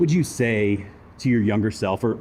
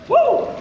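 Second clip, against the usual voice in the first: whoop; 7 dB louder than usual. Real, not acted. Plunge into ice bath.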